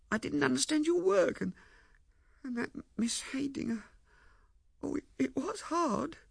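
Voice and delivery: very high, plaintive, faltering voice; tearful pleading. Acted. Ethel explains her hurt to Lewisham, tearfully. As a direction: pour it out haltingly, close to tears.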